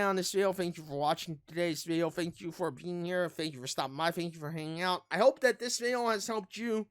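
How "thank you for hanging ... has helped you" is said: ordinary delivery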